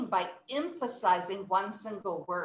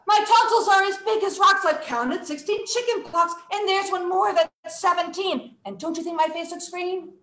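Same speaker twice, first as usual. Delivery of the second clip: high, urgent rush through the poem; loud, high, breathless-speed reading; fast recitation; 7 semitones higher, 5 dB louder than usual. Shown as a demonstration. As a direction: race through the verse on one breath. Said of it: "now we're gonna go fast".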